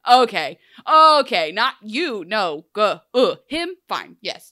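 loud, drawn okays settling into grudging yes; mock reluctance, loud at first then dropping off; reluctant acceptance. Sex worker weighing up a customer, reluctantly agreeing.

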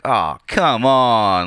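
slow, drawn-out groan of annoyance; exasperated groan. Retold or imitated. Reacting to the song on his iPod, slow and exasperated. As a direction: drag it out, fed up.

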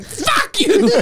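high laughing fuck you; the man snapping at the reporter, high; high mock curse. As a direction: fling it out high and giddy. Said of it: "him going".

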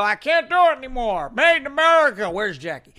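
high, loud mocking old president voice; mock Biden. Host mocks Biden quitting the race, in a high, loud mocking voice. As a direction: lampoon a worn-out president giving up. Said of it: "crap himself and go".

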